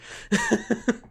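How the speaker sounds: high embarrassed laugh; burst of laughter. Real, not acted. Laughs at his own aborted attempt.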